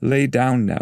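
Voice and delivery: falling, gentle command; Virgil's voice. Acted.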